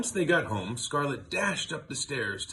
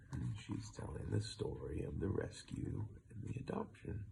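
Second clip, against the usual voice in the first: softer, nearly whispered; hushed aside; 4 semitones lower, 10 dB quieter than usual. Real, not acted. Reader explaining the picture under her breath.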